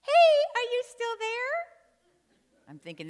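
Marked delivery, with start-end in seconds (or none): 0.0-1.5 put-on very high, loud kid calling out; high-pitched little-kid call; little child's voice. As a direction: pipe up loudly like a small child checking through the wall. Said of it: "the voice comes again".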